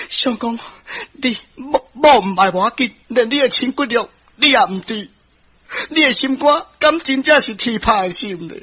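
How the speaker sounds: high, hurt reproach; angry wife. Acted. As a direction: upbraid your heartless husband. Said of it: "伊生气啊". (苦情妻子受氣責備)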